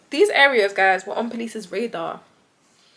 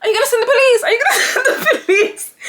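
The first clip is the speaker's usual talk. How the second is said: fake scared; 13 semitones higher, 8 dB louder than usual. Fake scared call, laughing.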